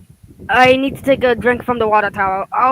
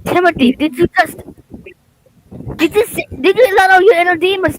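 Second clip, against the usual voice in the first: shocked; 7 semitones higher, 4 dB louder than usual. Acted. Shocked accusation of Timothy.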